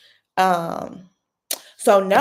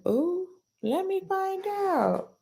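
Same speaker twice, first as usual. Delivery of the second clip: messy gossip tease; 11 semitones higher, 7 dB quieter, much slower than usual. Real, not acted. Gossipy aside about new girlfriend.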